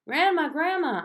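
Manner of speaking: high, loud calling out; excited child calling home; excited shout. A narrator's reading. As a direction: call Grandma from the door, thrilled. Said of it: "I shouted, very excited".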